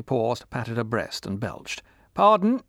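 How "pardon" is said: put-on high, loud boozy old woman; drunk old woman. A narrator's reading. Drunk old woman after belching.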